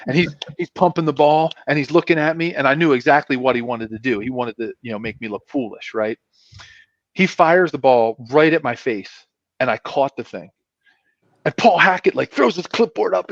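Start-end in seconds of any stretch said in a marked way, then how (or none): none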